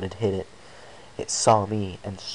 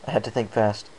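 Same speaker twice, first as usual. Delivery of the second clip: usual speaking voice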